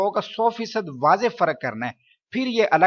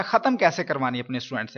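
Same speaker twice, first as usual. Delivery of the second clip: normal talking voice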